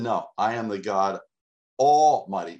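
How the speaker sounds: Almighty rising loud; emphatic declaration. Acted. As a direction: punch Almighty hard. Preacher voicing God.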